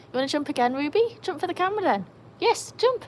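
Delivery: high, loud sing-song urging; coaxing dog voice. Real, not acted. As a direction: egg the dog on, high and bright. Urging dog to jump for camera.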